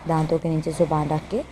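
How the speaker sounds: ordinary delivery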